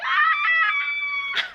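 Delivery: very high, excited squeal with a laugh; retold scream. Dad recalling his joy when baby said Papa, squealing. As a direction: shriek with delight. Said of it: "I was scream like".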